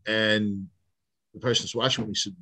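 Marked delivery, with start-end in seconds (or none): none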